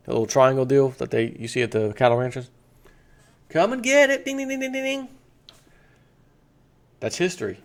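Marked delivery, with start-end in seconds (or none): 3.5-5.1 much higher, singsong dinner call; farm mama calling. Retold or imitated. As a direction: call the cows in like a ranch mother ringing the bell. Mama calling cattle to feed.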